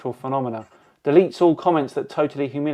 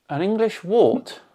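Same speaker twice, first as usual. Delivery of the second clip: "An English wart?" echoed back higher with a rising, disbelieving tune; incredulous echo; 6 semitones higher than usual. Real, not acted. Repeating the commenter's odd insult back in disbelief, higher and rising. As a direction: lift "wart" in baffled disbelief.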